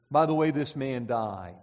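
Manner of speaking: ordinary talk, nothing marked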